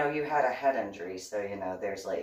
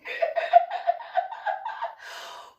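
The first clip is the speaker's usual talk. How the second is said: high shrieking laugh fit; helpless laughter; 4 dB louder than usual. Real, not acted. Cracking up over "domly", helpless high laugh. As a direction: lose it completely laughing.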